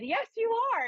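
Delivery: high insistent yes; mock insistent friend.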